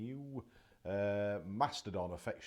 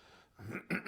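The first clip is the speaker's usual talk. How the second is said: quick throat clear; chesty little throat grunt; 5 semitones higher than usual. Real, not acted. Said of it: "grunting a little bit still".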